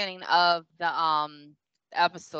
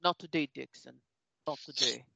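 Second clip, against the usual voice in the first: low, quiet and cool, a mock action-hero line; understated mock tough-guy delivery; cool movie line; 4 semitones lower, 6 dB quieter than usual. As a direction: drop the voice and deliver it like a calm one-liner. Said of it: "And he's like".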